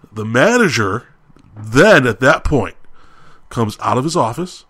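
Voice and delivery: normal talking voice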